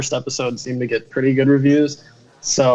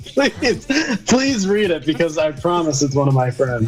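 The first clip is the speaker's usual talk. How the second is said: pleading; 5 semitones higher than usual. Real, not acted. Begging co-host to read spam.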